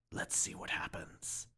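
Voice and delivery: breathy hushed whisper, barely voiced. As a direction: whisper it like the room is holding its breath. Tense wait before silencing foghorn.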